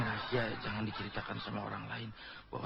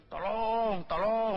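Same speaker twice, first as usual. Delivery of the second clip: loud, high-pitched comic yell; pretend cry for help; 12 semitones higher, 9 dB louder, much slower than usual. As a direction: shout as if calling the whole bus to rescue you. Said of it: "jadi tugas saya berteriak-teriak dalam bis". (teriakan minta tolong pura-pura)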